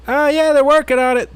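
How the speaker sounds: high, loud, breezy imitation; imagined niece posting. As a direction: toss it off high like someone's niece. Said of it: "have your fucking niece go on there and be like".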